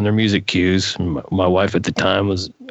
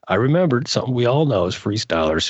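ordinary talk

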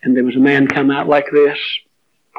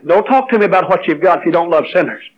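usual speaking voice

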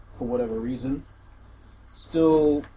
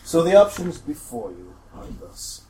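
slow, louder, grand npc delivery; formal npc voice; 6 semitones higher, 9 dB louder, slower than usual. Acted. Game master as an npc laying out choices, slow and grand. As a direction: announce the options like a stately envoy.

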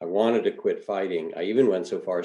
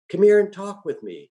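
put-on higher friendly invitation from an old man; easy old man; 12 semitones higher, 6 dB louder than usual. A narrator's reading. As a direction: coax him over gently. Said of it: "in an easy vernacular".

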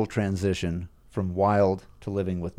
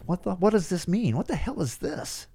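higher, softer, bewildered; baffled whisper-ish question; 9 semitones higher, 5 dB quieter than usual. Retold or imitated. Showing his wife the paper, a hushed baffled question. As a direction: ask it confused and hushed. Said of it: "and I'm like".

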